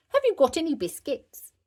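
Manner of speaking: high, loud toy-owl question; puppet owl. A narrator's reading. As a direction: ask as a child's puppet. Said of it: "Susie replies as Owlie".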